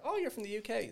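high-pitched mock of a clueless stranger; mocking imitation. Mimics people mistaking Irish for British.

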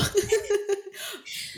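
high laughing burst. Real, not acted. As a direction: crack up. Laughing at the quote.

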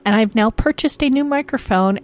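ordinary delivery

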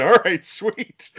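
high, slow, laughing "all right, sweet"; amused high-pitched mock approval; laughing mock reaction. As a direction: chuckle through it in a high, drawn-out voice.

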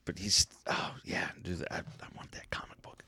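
hushed excited whisper. Real, not acted. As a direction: whisper it, barely voiced. Excited aside, very soft.

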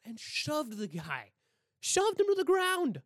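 high, loud, drawn-out yell; outraged shout. Real, not acted. Telling listeners about the cameraman, outraged shout. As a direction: yell it in disbelief.

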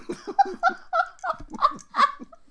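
very high, loud laughter; real squealing laughing fit. Real, not acted. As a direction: burst out in helpless laughter. Cracking up at tongue twister.

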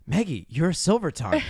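high, urgent schoolkid parody; imitating an earnest young wizard; mock Hermione and Ron. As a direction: break big news in a hammed-up high voice. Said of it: "when Hermione and Ron take Maggie aside".

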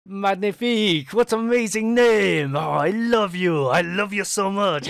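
high, loud and overjoyed; hammed-up French baker adoring a new friend; gushing French baker. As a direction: smother them with affection. Baker gushing over the party.

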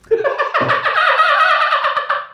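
sustained high shrieking laugh; long high laugh fit; long squealing laugh. Real, not acted.

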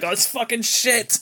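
high, slow strained heckle; mock-outraged crowd cry; imitated shouting. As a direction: draw it out like a disgusted fan. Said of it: "people are shouting, like".

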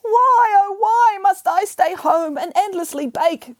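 high, slow, loud lament; crying. Acted. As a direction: wail it out slowly, high and loud. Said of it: "cried Pigarella".

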